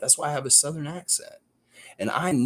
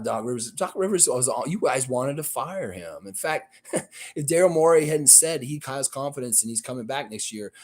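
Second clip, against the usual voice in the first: usual speaking voice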